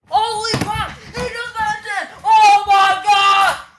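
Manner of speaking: shouting in disbelief, slowing right down; a real shout of shock at the kill; excited shout. Real, not acted. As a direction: yell it out, stunned. Teammate just got killed.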